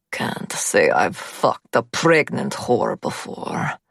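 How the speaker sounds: put-on slow, low leering Russian accent; lecherous gunman in a Russian accent. A narrator's reading. Gunman sneers.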